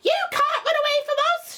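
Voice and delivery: high, loud screech of a shout; shouting Aunt Sponge. A narrator's reading. Aunt Sponge yelling at James in his dream, loud screech. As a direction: scream it like a nasty aunt. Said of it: "shouted Aunt Sponge".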